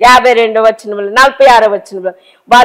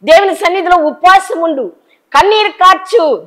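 fervent preaching; 8 semitones higher than usual. Real, not acted. Preacher exhorting the congregation.

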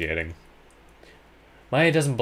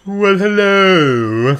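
high, slow bellboy greeting; a drawn-out, singsong hotel bellboy; chirpy old-fashioned bellhop; 11 semitones higher, 12 dB louder, much slower than usual. Acted. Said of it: "Now you're talking like the bellboy".

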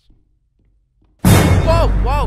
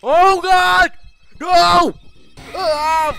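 panicked yelling; 13 semitones higher, 13 dB louder, slower than usual. Real, not acted. Panicked chase in game.

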